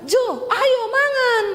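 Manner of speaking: high, drawn-out summons that sounds angry; piercing come-and-eat shout, unintentionally cross; Sulawesi high-toned call. Retold or imitated. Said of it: "Tapi nadamu tuh nesu".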